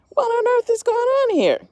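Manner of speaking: high, loud astonished question; bewildered bear. Acted. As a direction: bear can't believe it. Bear puzzled by crying crowd.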